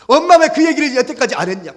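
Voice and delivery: high, loud, anguished daughter; performed tearful outburst; acted wailing reproach. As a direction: cry out to mother in mock weeping. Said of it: "다시 엉엉 우는 거예요".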